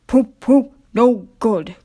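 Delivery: a touch of a grumbling big engine. Acted. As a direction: grumble it gruffly. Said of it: "grumbled Gordon".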